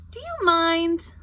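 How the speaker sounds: annoyed at being seen in the shower; indignant. Acted. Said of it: "It says".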